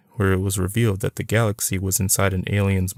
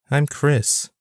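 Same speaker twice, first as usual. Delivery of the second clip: put-on girl's voice; 7 semitones higher than usual. A narrator's reading. Girl voiced in story.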